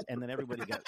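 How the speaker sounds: normal talking voice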